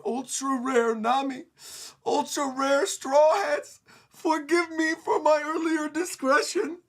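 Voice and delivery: slow starstruck fan voice; awestruck giant. Acted. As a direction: worship her like a rare collectible.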